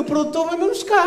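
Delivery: high-pitched European Portuguese impression; mock European Portuguese. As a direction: greet cockily in a put-on Portugal accent. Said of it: "só entende se falar assim".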